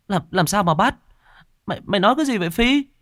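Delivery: worried sister firing questions; alarmed. Acted. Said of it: "hốt hoảng".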